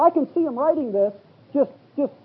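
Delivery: ordinary talk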